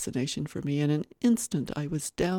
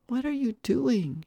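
put-on higher, slow childlike question; little boy; 8 semitones higher than usual. Little boy watching a stranger play, childlike curiosity. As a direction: ask as a curious small child.

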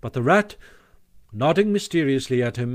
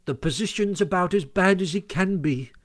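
higher-pitched, grumbling reply; grumpy; 6 semitones higher than usual. A narrator's reading. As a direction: answer like a disgruntled complainer. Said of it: "replied the Mole grumpily".

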